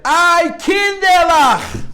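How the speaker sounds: high, loud, slow wail of Ay, Kinderlach; anguished cry. Retold or imitated. Survivor crying out to the orphans, high anguished slow wail. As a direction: cry out to the children as if your heart breaks. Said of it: "and he said".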